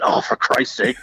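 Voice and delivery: mock muttered exasperation, half whispered; exasperated bandmates. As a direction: sigh it out like the band rolling their eyes. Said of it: "Ricky and them are going".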